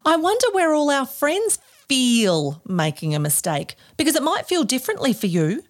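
ordinary talk

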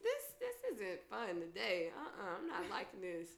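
soft, low grumble under her breath; muttered complaint. Retold or imitated. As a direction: keep it quiet, fed up. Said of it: "I was like".

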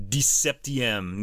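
dix-septième said slowly, each syllable clear; slow careful French. Shown as a demonstration. Host showing how Canadians say dix-septième, slow French. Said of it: "They'd say".

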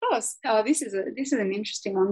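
ordinary delivery

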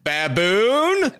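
put-on mild scold to a baby; warning tone. Shown as a demonstration. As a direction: say baboon like a scolding. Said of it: "I could go".

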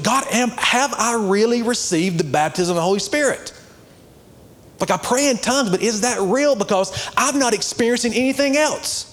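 high-pitched, emphatic sermon delivery; fired-up preaching. Real, not acted. Preaches with raised voice.

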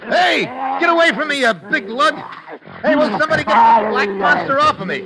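high, loud panicked yelling; shouting. Acted. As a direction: yell while fending off a dog. Attacked by a big dog.

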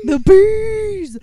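loud mock Nick Cage freakout; Nicolas Cage scream. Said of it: "I'm sorry for what I just did to your ears".

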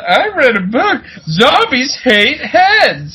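high, slow voice of a clueless guy; mock dumb guy voice. Movie guy sharing his zombie tip, high and slow. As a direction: squeak it out like a know-it-all fool. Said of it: "some guy who's like".

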